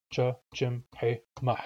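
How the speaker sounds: Cha, Chim, He, Mah as four separate short beats; four short syllables. Shown as a demonstration. Teacher showing learners four short syllables, short separate beats. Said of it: "it has four short syllables".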